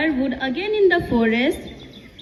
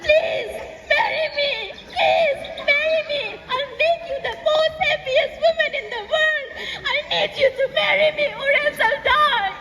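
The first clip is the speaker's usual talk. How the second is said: high-pitched, frantic pleading; desperate begging; 11 semitones higher than usual. A narrator's reading. Ayu on his knees proposing to Anuli, desperate begging. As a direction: beg as if life depends on it. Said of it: "went down on his knees and said".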